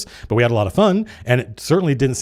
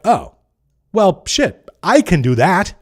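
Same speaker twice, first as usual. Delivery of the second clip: higher, louder voicing of a pleasant revelation; dawning realization; 7 semitones higher, 4 dB louder, slower than usual. Viewers realizing they could run a game, dawning realization. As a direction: act out someone suddenly getting it.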